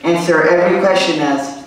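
ordinary talk, nothing marked

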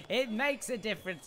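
bright emphatic insistence; emphatic restatement. Real, not acted. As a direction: press the point home. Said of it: "we quiet down in real life too".

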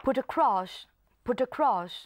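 "put across" run together as "pu-tacross"; put across linked. Shown as a demonstration. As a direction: carry the T of "put" onto "across". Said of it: "เรามาลองอ่านทั้งหมด". (อ่าน "put across" เชื่อมเสียงเป็นคำเดียว)

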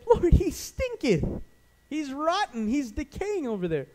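high, drawn-out protest; hammed-up high complaint; alarmed objection. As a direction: wail in disgust at the stench. Acted bystanders objecting in disgust.

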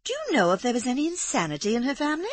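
light high society lady's question; Amanda. A narrator's reading. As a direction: ask eagerly as a fluttery young lady. Narrator voices Amanda.

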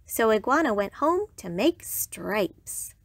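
plain reading voice

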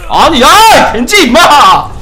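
loud, high, slow exasperated shout; angry yell. Real, not acted. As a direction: yell at the teammate. Yelling at teammate Genji.

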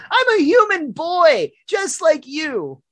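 very high, drawn-out indignant yell; Milhouse. Acted. Milhouse pleading his case to Bart, loud high wail. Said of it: "Use your indoor voice".

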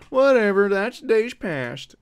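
high, loud, drawn-out brush-off; exaggerated dismissal. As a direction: shrug it off theatrically. Exaggerated repeat of whatever.

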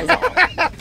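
high, sudden burst of laughter; short high-pitched laugh; amused laugh. Real, not acted. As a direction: laugh at being teased. Teased about his van lighting.

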